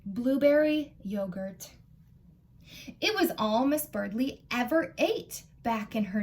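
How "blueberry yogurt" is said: plain reading voice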